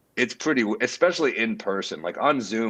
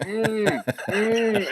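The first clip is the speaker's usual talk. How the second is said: high muffled gimp moans; gagged moaning; 12 semitones higher than usual. Retold or imitated. The hogtied opener moaning on live TV, high muffled. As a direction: moan high through a gag. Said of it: "he'd be like".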